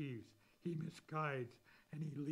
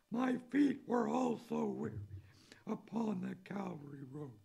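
higher, louder voice of Jesus; preacher quotes Jesus in the hymn, raised; voicing Jesus; 7 semitones higher, 5 dB louder than usual. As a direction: lift the voice for Jesus's reply.

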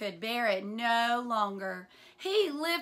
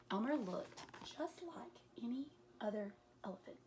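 soft, quick whisper; whispered narration; 13 dB quieter, faster than usual. A narrator's reading. Reading the story to children in a whisper. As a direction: hush it like a secret.

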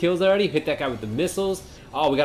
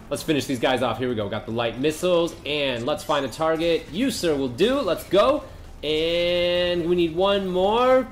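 usual speaking voice

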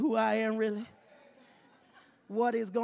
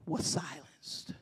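hushed; 8 semitones lower, 7 dB quieter, slower than usual. Acted. Dramatic hush on silence.

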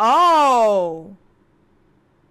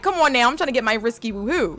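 ordinary talk, nothing marked

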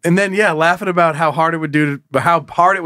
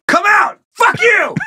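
very high strained shout; mock angry challenge; 4 dB louder, slower than usual. Musket shooter calls his victim out, mock angry challenge. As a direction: scream it in hammed-up fury.

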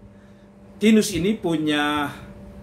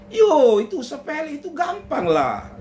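breezy wife; 10 semitones higher than usual. Acted. Wife repeats lightly.

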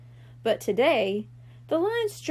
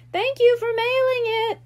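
high, loud little-brother call; shouted thanks; 11 semitones higher, 5 dB louder than usual. A narrator's reading. Shrimp calling after his sister, high and loud. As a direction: call it after her as she leaves. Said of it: "Shrimp shouted".

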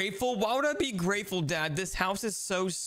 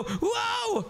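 excited shout. Acted. Rushing to tag brother.

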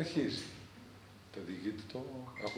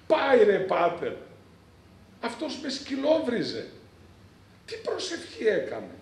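impassioned outcry; 7 semitones higher, 9 dB louder than usual. Retold or imitated. Penitent vehemently denounces his past.

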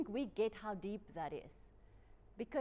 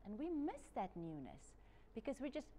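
normal talking voice

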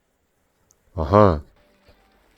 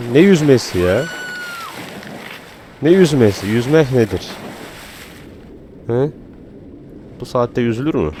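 mock outrage; 6 semitones higher than usual. Real, not acted. Gamer scoffing at night swimmers, high mock indignation.

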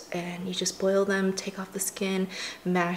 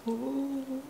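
cooing at pet; 5 semitones higher, 3 dB quieter, much slower than usual. Real, not acted. Watching the dog eat.

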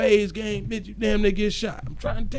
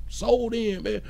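usual speaking voice

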